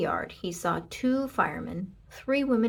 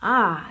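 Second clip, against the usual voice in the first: contented sigh; 8 dB louder than usual. A narrator's reading. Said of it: "said Leroy Ninker".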